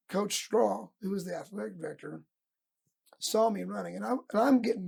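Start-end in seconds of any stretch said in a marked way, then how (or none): none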